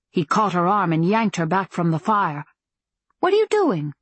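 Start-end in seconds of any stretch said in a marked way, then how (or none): 3.2-3.9 sharp high alarmed question; startled cry. Acted. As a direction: blurt it out in shock. Startled outcry by the fire.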